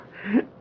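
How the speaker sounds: quick amused chuckle; a brief knowing laugh at the boss; short laugh. Acted. Lowry amused by babe remark.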